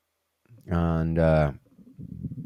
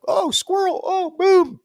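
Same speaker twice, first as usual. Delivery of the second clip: much higher, sudden bright squeal; distracted excitement; 4 dB louder, slower than usual. Mid-task distraction, a much higher excited squeal. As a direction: get yanked off task by a shiny thing. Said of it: "there's something like".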